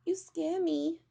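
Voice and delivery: high, loud tiny squeaky voice; frightened squeak. A narrator's reading. Teddy afraid of the buzzing bees, squeaking. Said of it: "he squeaked".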